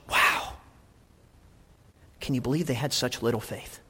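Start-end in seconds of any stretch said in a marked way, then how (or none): 0.1-0.5 understated, breathy wow; quiet disbelief; astonished. Acted. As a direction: let out a mildly stunned wow. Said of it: "he was astonished".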